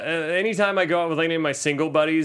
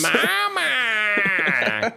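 high, long wailed monster cry; Razor; 6 semitones higher than usual. Retold or imitated. Host mimics the monster baby crying for mother, high drawn-out wail. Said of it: "what Razor sounds like".

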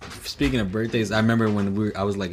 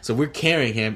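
ordinary talk, nothing marked

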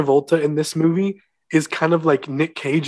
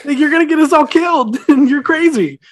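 hammed-up frantic sidekick begging him to stop; panicked protest; 11 semitones higher, 5 dB louder than usual. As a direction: play the terrified passenger freaking out. Mocking panicked passenger's protest.

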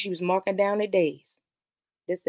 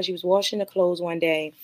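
ordinary delivery

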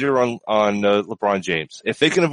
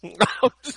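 giggle; 8 semitones higher than usual. Real, not acted. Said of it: "I gotta change the video".

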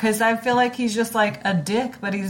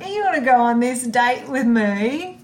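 put-on drunk Mary Poppins; 6 semitones higher than usual. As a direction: slur a bad posh British accent. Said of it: "you sound like a drunk Mary Poppins".

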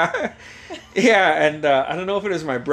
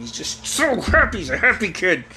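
high-pitched mock delight; imitated teasing dad; 9 semitones higher than usual. Dad showing grandma kid's sock disappointment, teasing gush.

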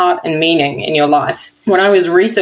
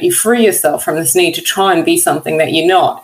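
usual speaking voice

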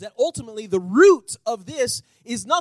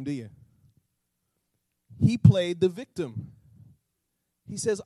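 usual speaking voice

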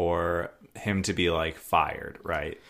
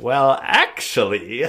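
slow, drawn-out, louder opportunist; angling for favor; 3 semitones higher, 6 dB louder, slower than usual. Retold or imitated. Errand boy cashing in a favor, slow and drawn out. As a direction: stretch it out, setting up the ask. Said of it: "He's like".

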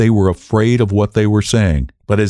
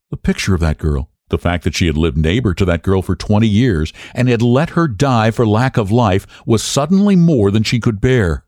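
ordinary delivery

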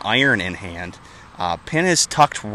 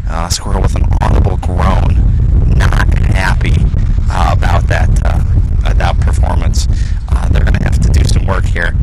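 louder excited putt call; golf commentator; 7 dB louder than usual. Acted. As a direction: call the putt with rising energy.

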